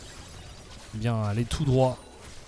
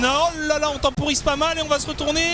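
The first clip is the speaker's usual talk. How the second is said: raised, lively play-by-play call; esports caster; 10 semitones higher than usual. Acted. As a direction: call the fight live, high and energetic. Live play-by-play.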